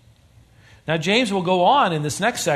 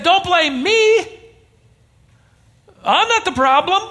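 high, louder defensive excuse; Eve's plea; 13 semitones higher, 3 dB louder than usual. Retold or imitated. Eve excusing herself to God, high defensive whine. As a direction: push the blame away, high and pleading. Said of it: "And Eve said".

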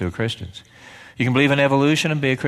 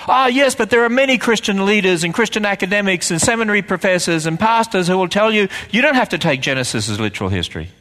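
imitating a senator; 6 semitones higher than usual. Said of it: "You know what they'll say to you?".

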